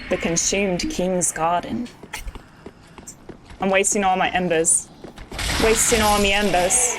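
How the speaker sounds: hammed-up amateur British accent; bad British accent. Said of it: "a really bad British accent that I'm doing".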